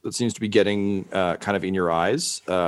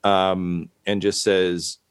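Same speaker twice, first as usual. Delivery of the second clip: ordinary delivery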